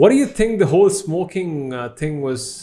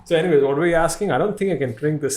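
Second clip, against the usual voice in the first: ordinary delivery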